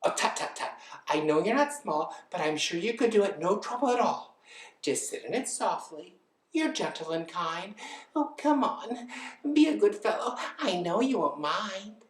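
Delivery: wheedling bird voice; coaxing lazy bird. Acted. Bird persuades reluctant elephant, wheedling. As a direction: talk him round, rising high as you coax.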